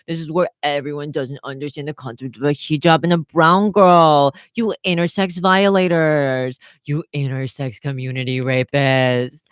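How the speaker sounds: theatrical ranting crescendo; escalating outrage. Acted. Acted escalating accusation in argument.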